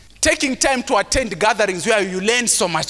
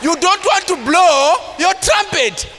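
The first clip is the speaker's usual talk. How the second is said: preaching at full force; 5 semitones higher than usual. Acted. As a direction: preach it hard, rising and shouting.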